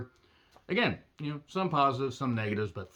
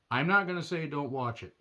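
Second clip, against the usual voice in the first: normal talking voice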